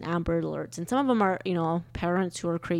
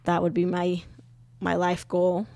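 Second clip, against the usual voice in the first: ordinary delivery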